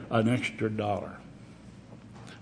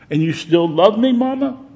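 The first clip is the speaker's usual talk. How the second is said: put-on boy's plea, louder and rising high on Mama; pleading child; 9 semitones higher, 7 dB louder than usual. Willie begs his mother, high pleading child. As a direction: ask it like a hurt child begging.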